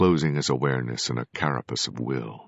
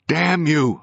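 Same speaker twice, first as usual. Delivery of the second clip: high, loud scream; 13 semitones higher, 7 dB louder than usual. A narrator's reading. A ghost screaming at his corpse, loud. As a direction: scream the curse in horror. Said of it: "I screamed".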